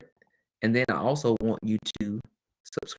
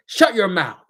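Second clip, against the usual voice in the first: higher, louder snap; sharp teacher command; 9 semitones higher, 8 dB louder than usual. Retold or imitated. Teacher stopping students mid-argument, sharp raised command. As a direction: cut the kids off hard. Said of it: "So I said".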